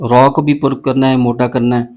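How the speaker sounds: ordinary talk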